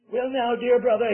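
quaint English voice. Retold or imitated. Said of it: "he said in his quaint English vo-, voice".

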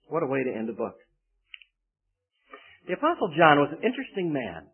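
ordinary talk

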